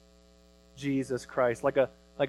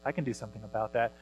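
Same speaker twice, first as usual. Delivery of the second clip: God speaking tenderly; 4 semitones lower, 7 dB quieter, much faster than usual. Retold or imitated. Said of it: "he looks at us and he says".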